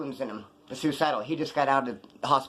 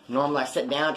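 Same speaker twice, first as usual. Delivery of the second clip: ordinary delivery, nothing marked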